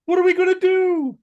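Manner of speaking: high, loud frantic question; frantic high cry; panicked. Acted. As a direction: plead in panic. Freddy panicking in script.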